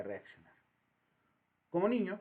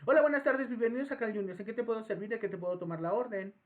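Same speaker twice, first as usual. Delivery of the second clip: higher, louder, quick rote greeting; faster cashier; 5 semitones higher, 4 dB louder, faster than usual. Retold or imitated. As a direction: rush through the welcome script. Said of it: "lo hacen de manera más rápida".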